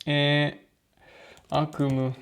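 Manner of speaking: normal talking voice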